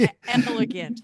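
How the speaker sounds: laughing through the word; amused, half-laughing repeat. Real, not acted. Mocking the word elegant.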